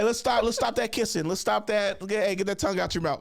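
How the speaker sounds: high, loud hammed-up scolding; squeaky mock-stern order to stop kissing. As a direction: break up the kiss like a fussy chaperone. Breaking up the kissing couple.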